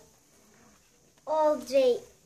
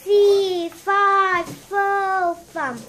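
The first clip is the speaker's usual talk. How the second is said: loud, higher giant roar; giant shout; 3 semitones higher, 7 dB louder, much slower than usual. Acted. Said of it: "And shouted".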